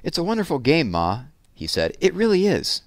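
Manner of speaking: put-on boy's earnest enthusiasm; eager pleading boy. A narrator's reading. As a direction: sell the game to your ma.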